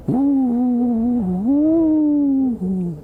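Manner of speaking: high, drawn-out ghost wail; ghostly wail. Acted. Henry haunting the woods, high ghostly wail. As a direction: wail like a phantom in the trees. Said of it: "wailed Henry".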